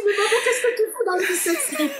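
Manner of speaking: put-on very high child's voice; imitated young son. Son asking mum about being on the show, high imitated voice. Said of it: "Mon fils, il dit".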